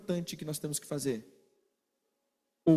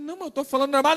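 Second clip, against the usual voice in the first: mock defensive; 7 semitones higher, 4 dB louder, much faster than usual. Acted quarrel, defending side.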